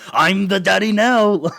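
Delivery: a hint of a grand villain's boast; mock Matt Berry line. Fan quoting a favourite line, mild mock grand boast. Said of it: "I really thought you were about to say".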